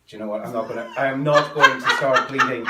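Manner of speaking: laughing loudly through the words; cracking up mid-sentence. Real, not acted. Host breaks up mid-appeal.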